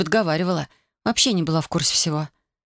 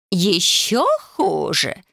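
shouted; 2 semitones higher, much slower than usual. A narrator's reading. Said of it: "закричала мисс Апрел".

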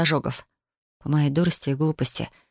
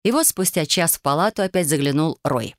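usual speaking voice

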